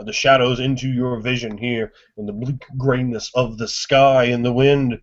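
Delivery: normal talking voice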